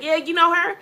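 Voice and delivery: spring it on him loudly; acted gotcha question; triumphant confrontation. Confronting him over the phone, retold.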